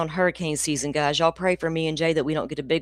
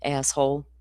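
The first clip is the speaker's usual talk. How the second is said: ordinary talk, nothing marked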